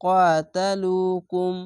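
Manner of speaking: ইউকাতিলুকুম recited slowly in a chanting Quran voice; recitation voice. Shown as a demonstration. Teacher to students, reciting slowly for practice. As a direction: chant ইউকাতিলুকুম with long vowels. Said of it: "এক আলিফ টেনে পড়বেন".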